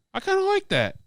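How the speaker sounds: high-pitched quick aside, imitating someone; quoted comedian's aside. Retelling a comedian turning to his sidekick, high-pitched aside. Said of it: "he turns to Ben and goes".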